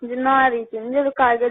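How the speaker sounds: ordinary delivery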